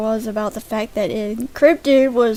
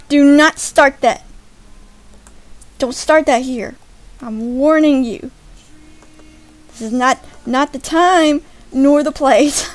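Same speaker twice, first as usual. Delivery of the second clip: loud, emphatic mock scolding; raised, firm telling-off; mock warning; 4 semitones higher, 11 dB louder than usual. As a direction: lay down the law, playfully. Mock-scolding viewers over a joke.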